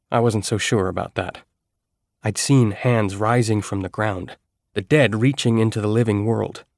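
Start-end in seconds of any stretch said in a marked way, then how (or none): none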